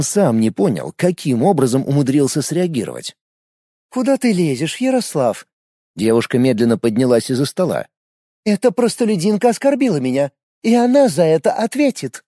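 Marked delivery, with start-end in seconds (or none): 3.9-5.5 put-on young woman's voice. A narrator's reading. Female character questions Yaroslav. 8.4-12.2 put-on haughty young woman, higher voice; a proud girl taking offence; offended noble girl. A narrator's reading.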